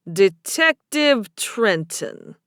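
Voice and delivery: very slow Boston bartender voice; Boston barman, drawn out; thick Boston accent. A narrator's reading. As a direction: savour the name in a Boston accent. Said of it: "a man with a thick Boston accent".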